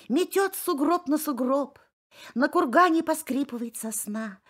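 plain reading voice

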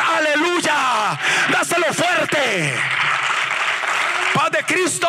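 high, shouted revival preaching; full-force preaching. Acted. Preacher urging the church to praise, shouting high. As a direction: rouse the congregation to applaud.

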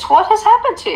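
what happened to you, teasingly; mock dismay.

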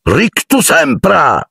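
high, slow spell cry; shouted spell. A narrator's reading. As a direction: fling the spell out. (incantesimo gridato)

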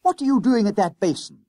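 high, loud, indignant schoolboy; indignant cry. A narrator's reading. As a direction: call out in affronted protest. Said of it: "he cried indignantly".

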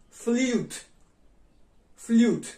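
"Flute" loud with long yoo. Shown as a demonstration. Teacher models long u in "Flute". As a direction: draw out the u in "Flute". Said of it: "овози дарозаш".

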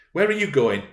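high, loud challenge; sharp demand. Acted. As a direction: demand an answer. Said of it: "demanded Catherine".

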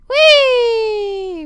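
very high, loud playful squeal; gleeful whee. Acted. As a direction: squeal like on a slide. Rolling down in game.

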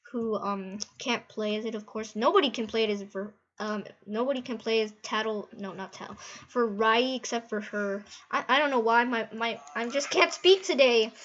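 ordinary delivery

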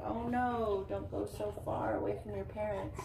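plain reading voice

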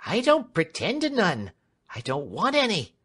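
high, loud voice for a cheerful servant woman; Clemency, good-humoured servant. Acted.

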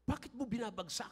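mock the boy's complaint; imitating a child. Grandfather quoting his annoyed grandson, lightly voiced child. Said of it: "Sabi niya".

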